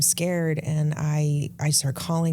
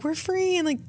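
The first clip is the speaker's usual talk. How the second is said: 1 joyful; 14 semitones higher than usual. Retold or imitated. Dancing in the grass, liberated.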